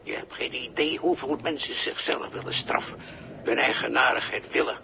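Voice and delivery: ordinary delivery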